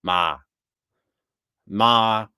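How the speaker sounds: Ma with a Wisconsin accent. Shown as a demonstration. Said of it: "how do you say Ma in a Wisconsin accent?".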